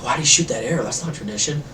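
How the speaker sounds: lightly voiced puzzled onlooker; understated bystander's grumble; crowd murmuring. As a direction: mutter it in mild disapproval.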